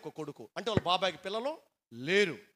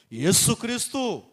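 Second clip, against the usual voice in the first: 1 proclaimed name; 5 semitones higher, 10 dB louder, slower than usual. Acted.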